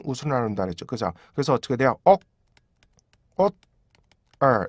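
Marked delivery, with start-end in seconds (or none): 2.0-4.7 옥, 옷, 얼 as three separate beats; syllable drill. Shown as a demonstration. Teacher splits the word into beats for students. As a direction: break or into three stops. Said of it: "이렇게 되죠".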